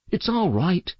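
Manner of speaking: ordinary delivery, nothing marked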